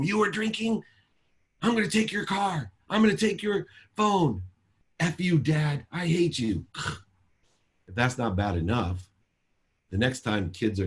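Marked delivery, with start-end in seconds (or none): 0.0-4.4 much higher, hammed-up outraged yell; mock angry dad yell. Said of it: "Why are you yelling at me". 5.0-6.7 put-on much higher, bratty angry teen; mock teen outburst. Daughter snaps back at dad.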